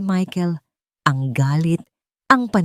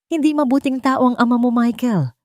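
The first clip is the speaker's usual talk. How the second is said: higher, tearful, understated; a touch of weeping in the voice; mild tearful admission; crying; 6 semitones higher than usual. A narrator's reading. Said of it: "Umiiyak na".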